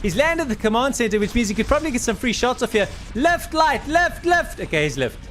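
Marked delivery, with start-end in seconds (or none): 3.1-4.6 excited urging. Real, not acted. Caster urging player mid-fight.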